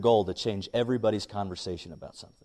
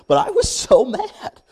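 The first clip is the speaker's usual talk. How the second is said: high, loud comic outburst; hammed-up frustration, pitched up and loud; exasperated; 9 dB louder, slower than usual. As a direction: burst out in retold exasperation. Recalling how mad he was.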